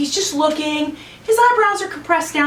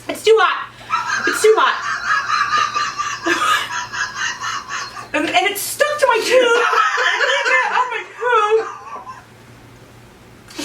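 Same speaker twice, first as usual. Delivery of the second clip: laughing burning-mouth shout; 12 semitones higher than usual. Real, not acted. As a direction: yelp it out through laughter. Pepper burning her mouth.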